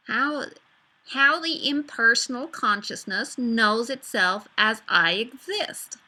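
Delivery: slow, stretched Californian drawl on a spiritual line; Californian accent. Pretend. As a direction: savour each word like a laid-back Californian. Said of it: "the American one".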